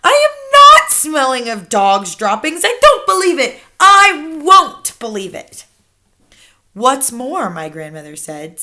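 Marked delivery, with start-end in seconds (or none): 0.0-5.6 loud, high, outraged denial; indignant cry. A narrator's reading. Child refusing grandmother's claim, crying out indignantly. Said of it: "I cried". 6.7-7.5 lifted, louder lead-in; touch of relish. A narrator's reading. Grandmother adding to the insult, with relish. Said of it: "speaking with a touch of relish".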